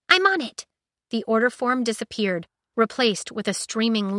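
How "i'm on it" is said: high, bright eager android voice; high excited AI chatter; chirpy ship AI. Acted. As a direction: pitch it up as an excited ship computer. Aiko voiced.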